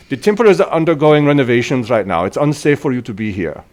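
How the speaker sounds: put-on officious old man turning visitors away; firm, in-character warning; older temple priest. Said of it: "this older human walks past you all".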